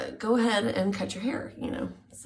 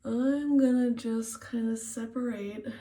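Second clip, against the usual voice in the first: ordinary delivery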